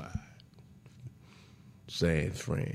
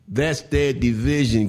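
louder and slower, leaning hard on "their"; emphatic repeat; 4 semitones higher, 11 dB louder than usual. Shown as a demonstration. Host repeating to listeners, loud and emphatic. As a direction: hammer it home, slowly and loud.

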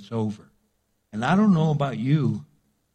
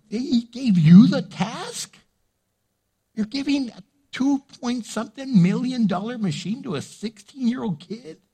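high, loud disbelief; can't believe what you're hearing; incredulous; 9 semitones higher, 4 dB louder than usual. Retold or imitated. Grandparent stunned that the teacher handed the kid the machine, high and incredulous.

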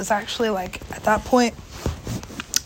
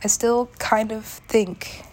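normal talking voice

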